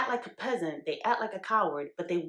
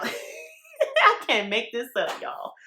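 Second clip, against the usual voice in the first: laughing disbelief; 7 semitones higher, 6 dB louder, much slower than usual. Real, not acted. Laughing at her own story.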